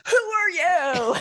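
loud, high mock-outraged yell; shocked shout. Isaac confronts his returning son, shocked shout. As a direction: yell it in comic alarm.

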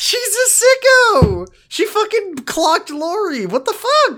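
very high squeal of disbelief; shocked outburst. Acted. Host reacts to who clocked Lori, high-pitched shock. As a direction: exclaim it far up in pitch, scandalized.

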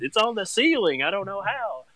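high, incredulous exclaim; mock disbelief. Dad telling the podcast host about the mess, high and incredulous.

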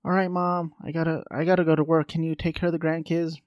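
mock busy grown-up child; grown kid asking mom. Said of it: "her kids are like".

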